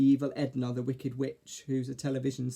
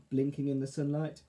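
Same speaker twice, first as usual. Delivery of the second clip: ordinary delivery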